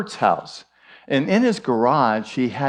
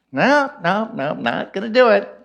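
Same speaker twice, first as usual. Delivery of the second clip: parrot voice; 6 semitones higher than usual. Acted. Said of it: "parrot refuses again".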